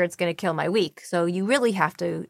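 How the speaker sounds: ordinary delivery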